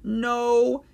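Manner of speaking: high, drawn-out groaning denial; pained, high-pitched "no"; embarrassed admission. A narrator's reading. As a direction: confess it from behind your hands. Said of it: "he said through his fingers".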